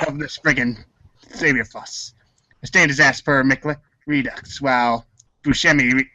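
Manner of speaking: usual speaking voice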